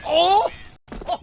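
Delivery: sudden high, loud exclamation; excited shout. Real, not acted. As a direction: yell out in surprise. Caster reacts to big play.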